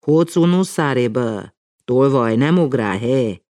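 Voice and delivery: loud, high scolding; scolding the dog. A narrator's reading. Mama threatens the dog, loud high scolding. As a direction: wag a finger and scold loudly. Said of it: "megfenyegette a kutyát".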